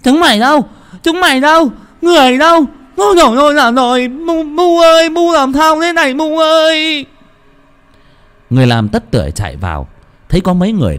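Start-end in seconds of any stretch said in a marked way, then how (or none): 0.0-7.1 high, loud panicked shrieks for help; a girl screaming as her mother convulses; shrill-pitched panicked child calling for mother; frantic screaming girl. Acted. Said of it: "vang lên tiếng hét".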